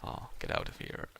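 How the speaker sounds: ordinary talk, nothing marked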